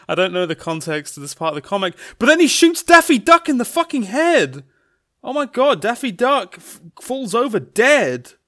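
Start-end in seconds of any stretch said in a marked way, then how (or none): 2.2-8.3 high, loud, melodramatic outcry; shocked narration. A narrator's reading. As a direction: tell the shock twist as if horrified. Narrator acts shocked at the shooting.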